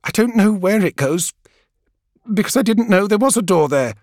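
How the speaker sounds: high, shaky frightened pleading; tremulous voice. Acted. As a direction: plead while fighting back fear. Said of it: "her voice tremulous".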